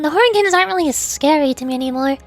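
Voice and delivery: ordinary delivery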